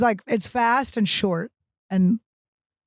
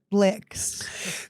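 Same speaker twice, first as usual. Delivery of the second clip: a soft whispered punchline; 5 dB quieter than usual. Acted. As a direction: breathe the word out dirty. Podcast name punchline.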